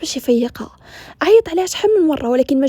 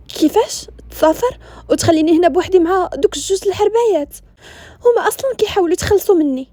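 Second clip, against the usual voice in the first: shocked protest; 6 semitones higher than usual. Acted. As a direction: protest in disbelief at being left alone. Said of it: "هزات عينيها مصدومه".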